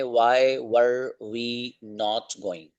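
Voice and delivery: each word drawn out slowly; slow dictation; slow drill. Shown as a demonstration. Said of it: "six number का जो sentence है".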